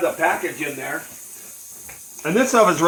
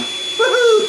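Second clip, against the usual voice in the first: high, loud woohoo; excited cheer; 7 dB louder than usual. Real, not acted. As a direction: whoop it out happily. Cheering at the video's end.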